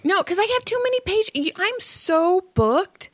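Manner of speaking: high, put-on excuse voice; mock excuse. Therapist's excuse for not rebooking insane client, high mock excuse. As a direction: rush in high, then stretch it out.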